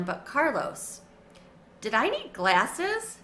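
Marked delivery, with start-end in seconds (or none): none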